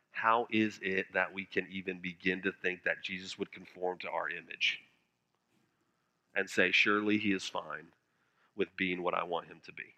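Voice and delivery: quiet, weighty appeal to the congregation; hushed solemn preaching. Real, not acted. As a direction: drop to a grave hush, leaving long pauses. Preacher drops into hushed solemn passage.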